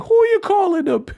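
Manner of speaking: high, outraged [name]; [name] impression. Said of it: "made me feel like [name]".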